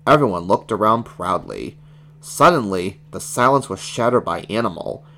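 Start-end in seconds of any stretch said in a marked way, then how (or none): none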